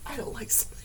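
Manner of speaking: shaken whisper; breathy whisper after a fright. Real, not acted.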